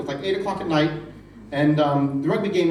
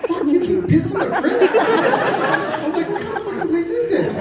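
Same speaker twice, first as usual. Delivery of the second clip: higher, louder laughing outrage; shocked disbelief; 13 semitones higher, 5 dB louder than usual. Retold or imitated. American guest reacting to piss in the fridge, shocked and loud. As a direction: yell in disbelief at the strange custom.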